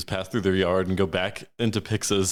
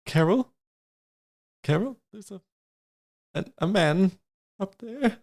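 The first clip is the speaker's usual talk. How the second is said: higher, hesitant call for someone; lightly acted uneasy neighbour alerting someone; calling for Carol; 8 semitones higher than usual.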